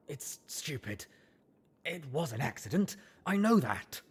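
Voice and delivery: thin voice cracking upward. A narrator's reading. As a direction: let the voice crack and break high. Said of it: "His thin voice cracked again".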